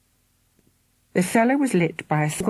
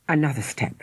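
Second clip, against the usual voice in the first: plain reading voice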